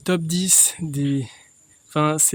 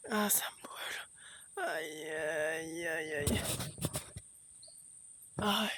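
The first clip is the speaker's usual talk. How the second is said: slow, soft sobbing whimpers; crying in pain; 11 dB quieter, much slower than usual. Real, not acted. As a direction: whimper and sob from the burning.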